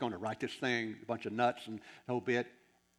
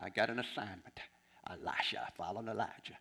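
low, whispered aside to himself; hushed aside; 4 semitones lower, 6 dB quieter than usual. Retold or imitated. Preacher muttering to himself about his assignment, hushed aside.

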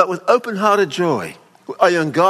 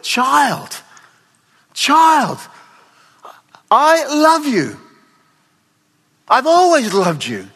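higher, pleading tenderly, slow on I love you; tender father; 8 semitones higher, 4 dB louder than usual. Acted. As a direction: beg the lost son gently, full of love. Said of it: "the word in Greek is a tender word".

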